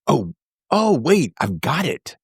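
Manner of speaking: eureka moment. Retold or imitated. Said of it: "do you remember that moment where you went".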